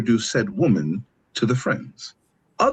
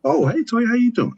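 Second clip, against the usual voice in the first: much higher, cheerful hello to Toya; bright friendly greeting; 11 semitones higher than usual. Retold or imitated. Friend greeting the girlfriend politely, high and cheerful.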